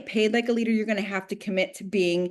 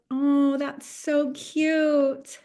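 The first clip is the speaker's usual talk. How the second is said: slow, patronizing, slightly higher "so cute"; condescending coo; 5 semitones higher, much slower than usual. Retold or imitated. Nam dismissing her income, slow and patronizing. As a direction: coo it down at her like she's a child. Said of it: "And he goes".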